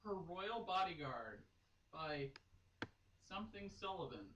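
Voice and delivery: normal talking voice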